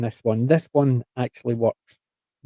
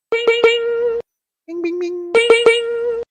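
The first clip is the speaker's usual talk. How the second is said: high bing sound effect; much slower than usual. Shown as a demonstration.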